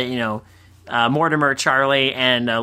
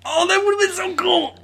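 real high-pitched fan excitement; excited. Real, not acted. Reacting to a cut cameo scene, thrilled squeal. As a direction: gush with delight, voice shooting up.

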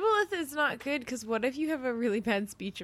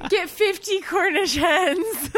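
higher, louder and slow; 7 semitones higher, 6 dB louder, slower than usual. Real, not acted. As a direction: proclaim it loudly and slowly. Escalating joke, shouted.

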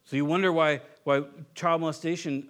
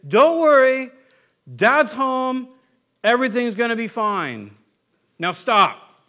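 loud, higher take-charge dad voice; mock overbearing dad reassuring then ordering; bossy dad; 6 semitones higher, 11 dB louder, slower than usual. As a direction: bark it like a father barging in. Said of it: "insert ourselves ... and say".

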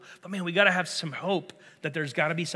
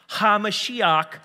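"HaMashiach" said slowly and louder, each syllable drawn out; slow emphasis; 5 semitones higher, 6 dB louder, much slower than usual. Shown as a demonstration. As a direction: stretch "HaMashiach" slowly and firmly. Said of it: "Say HaMashiach".

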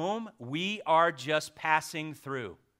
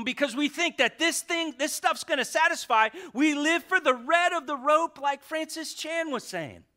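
loud, high, impassioned preaching; fiery pulpit cry; preaching at full force; 13 semitones higher, 10 dB louder than usual. Real, not acted. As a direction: build it up and preach it out hard.

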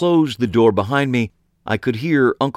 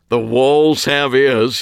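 slow, louder, higher mock-spooky voice; 5 semitones higher, 5 dB louder, slower than usual. A narrator's reading. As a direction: ham up a ghostly warning, slowly. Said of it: "supposed to be a spooky manner".

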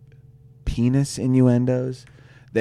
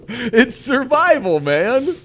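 high drawn-out mock wisdom; 12 semitones higher, 8 dB louder, slower than usual. Mock-serious punchline.

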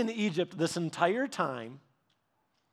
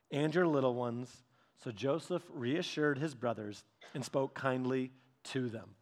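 soft, measured scripture reading; quieter, lower Bible reading, unhurried; 4 semitones lower, 6 dB quieter than usual. A narrator's reading. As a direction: read the passage gently, pausing between phrases.

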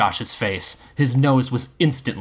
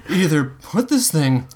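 high, strained bravado; high slurred ultimatum; slurred faux confidence; 9 semitones higher than usual. A narrator's reading. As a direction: threaten with shaky fake confidence. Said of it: "he slurred with faux confidence at Cronish".

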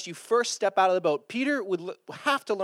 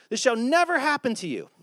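higher-pitched, emphatic refusal; rebuking protest; 8 semitones higher than usual. Acted. Disciple rebuking his teacher, high emphatic protest. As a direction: insist firmly, pitched up. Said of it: "began to rebuke him".

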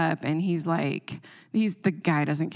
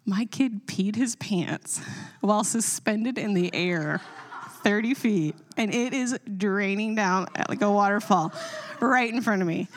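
telling it through laughter, higher; laughing; 3 semitones higher than usual. Real, not acted. Mom telling friends the embarrassing moment, laughing. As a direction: laugh while you confess it.

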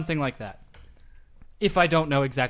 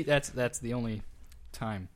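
ordinary talk, nothing marked

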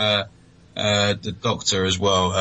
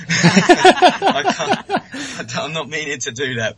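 breaking into high laughter; giggling through the apology; real laughter mid-sentence; laughing; 8 semitones higher, 5 dB louder, slower than usual. Real, not acted. Laughing at own accent slip.

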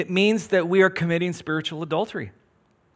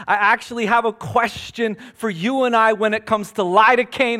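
preaching; 3 semitones higher than usual. Acted. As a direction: preach it out with rising force. Preaches over music, rising force.

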